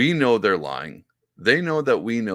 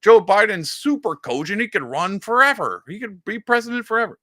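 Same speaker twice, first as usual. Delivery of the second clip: mock gushing pundit, high and loud; hammed-up praise, pitched way up; Morning Joe; 11 semitones higher, 5 dB louder than usual. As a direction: parody an overexcited TV cheerleader. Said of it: "Every time Morning Joe come, came on, was like".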